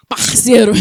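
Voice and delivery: loud carioca "Parceiro" with a forceful R; Rio accent. Shown as a demonstration. Preacher showing the accent of home, "Parceiro" with strong Rio R. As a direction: hit the R in "Parceiro" hard, Rio style. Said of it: "é um R forte".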